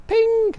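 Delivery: high-pitched lift chime; imitated lift ping. Storyteller mimics the lift arriving, high imitated ping. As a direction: sing out a high little "ping".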